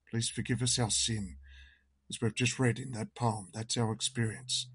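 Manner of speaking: usual speaking voice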